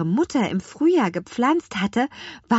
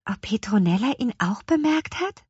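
plain reading voice

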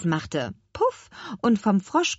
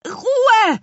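shouted command; 10 dB louder than usual. A narrator's reading. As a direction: shout them all silent. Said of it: "rief Petronella". (lauter, schriller Ruf)

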